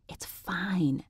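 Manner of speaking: soft breathy whisper. A narrator's reading. Narrator calming Laura outside the room in a whisper. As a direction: hush it so nobody hears. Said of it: "I whispered".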